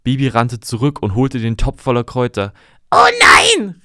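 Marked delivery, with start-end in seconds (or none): 2.9-3.8 high, loud panicked shriek; shrill witch's panic, much higher; panicked cry. Acted. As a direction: scream it out in sudden panic. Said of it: "rief sie panisch".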